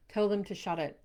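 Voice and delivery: ordinary talk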